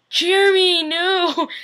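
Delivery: high, loud shocked outburst; shocked cry. Real, not acted. Vlogger reacting to Jeremy being in danger, shocked high cry.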